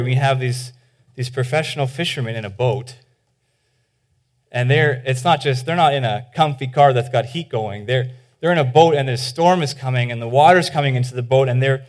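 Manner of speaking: normal talking voice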